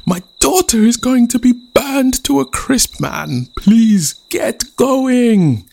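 high, loud begging; panicked chief. Acted. Chief begging Rain to race, panicked. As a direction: plead in desperation.

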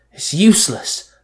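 coughing out the words. Acted. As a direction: cough as you speak. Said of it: "Max coughed".

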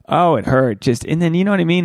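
normal talking voice

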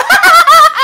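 high, loud cackling scream; maniac Joker laugh. Retold or imitated. Lady laughing from the car window, imitated maniac cackle.

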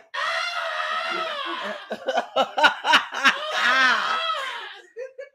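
long, very high wailing scream breaking up with laughter; imitated scream. Mimicking a friend on the slide, long scream. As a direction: imitate a terrified rider shrieking on and on. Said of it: "Hundred percent accurate".

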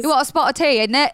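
put-on half-hearted British accent, teatime cliché; bad British accent. Host jokingly doing a British accent for guests. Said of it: "you wanna hear my British accent".

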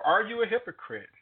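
ordinary talk, nothing marked

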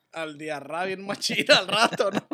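giggling while joking, slowed; laughing. Real, not acted. Joking about the aftermath.

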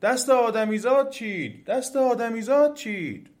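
loud, high, drawn-out alarm; trees shouting. A narrator's reading.

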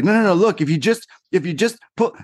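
high, imitated mom straining as she tugs; frantic insistence. Mother pulling at daughter's trapped finger, high and frantic.

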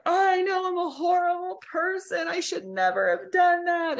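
high, whiny imitation of a partner breaking down; mock sobbing wail. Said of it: "they just start crying".

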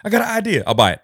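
hammed-up urgent sales pitch; eager pitch. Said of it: "Mark Cuban's there".

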